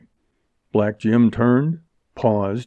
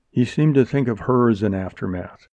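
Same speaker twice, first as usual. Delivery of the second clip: ordinary delivery, nothing marked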